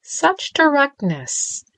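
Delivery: high, slow sing-song titter. A narrator's reading. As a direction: toy with her, giggling over each word. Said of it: "the sing-song voice on the other end tittered".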